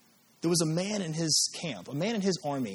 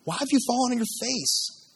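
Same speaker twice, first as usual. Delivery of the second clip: raised, higher-pitched command; mild mock yell; 7 semitones higher, 3 dB louder than usual. God rebuking Joshua, higher-pitched mock yell. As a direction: scold him from above. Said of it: "He screams at him".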